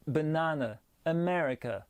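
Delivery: "banane" and "America" in English with weak uh vowels, strong second syllable; light unstressed E. Shown as a demonstration. Teacher repeating examples, English "banane" and "America" with light E. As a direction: barely sound the outer vowels of "banane" and "America". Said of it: "mais bien très léger".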